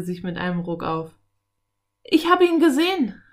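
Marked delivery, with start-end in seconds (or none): 2.0-3.2 put-on high, loud excited boy; boy's excited outburst; excited shout. A narrator's reading. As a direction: cry it out, bursting with the news. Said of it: "rief er aufgeregt".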